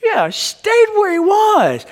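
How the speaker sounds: high, slow, drawn-out disbelief; incredulous repeat. Acted. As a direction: stretch it out in shock.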